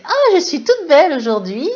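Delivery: cheerful mirror talk, hammed up; mock self-praise in the mirror. Shown as a demonstration. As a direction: gush at your reflection like you mean it. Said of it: "vous regardez dans le miroir le matin, euh, vous dites".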